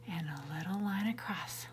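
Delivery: put-on teacher whispering the stroke to the kids; whispered. Shown as a demonstration. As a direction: whisper it like a secret. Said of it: "One straight line down".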